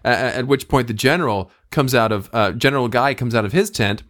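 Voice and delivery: normal talking voice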